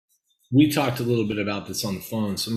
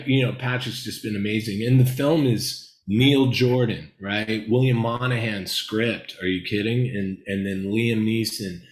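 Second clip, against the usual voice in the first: normal talking voice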